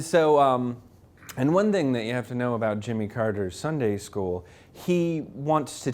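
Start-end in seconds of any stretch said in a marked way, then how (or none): none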